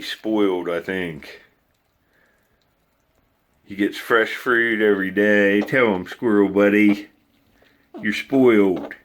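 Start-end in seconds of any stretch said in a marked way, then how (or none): none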